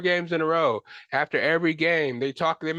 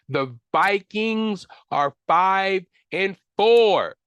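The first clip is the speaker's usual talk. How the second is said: louder and higher, each word dragged out in disbelief; slow, loud, higher cry of disbelief at the Vikings' record; exasperated outburst; 5 semitones higher, 5 dB louder, much slower than usual. Real, not acted. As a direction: belt the record out like you can't believe it. Fan repeats the Vikings' record.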